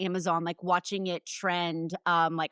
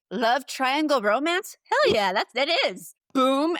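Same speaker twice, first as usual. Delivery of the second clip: hyped; 5 semitones higher, 4 dB louder than usual. Retold or imitated. Mock hyped self-quote about categories.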